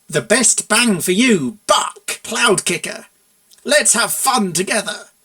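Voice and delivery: slow, loud, emphatic read of the slogan; reading slogan aloud. A narrator's reading. Mare reading the awful slogan aloud, slow and emphatic. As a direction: read out each word in mortified disbelief.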